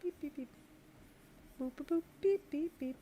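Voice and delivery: high, soft robot-like beeps and boops; idle beeping noises. Pretend. Artist colour blocking, playful high beeping. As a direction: hum little beeps while busy.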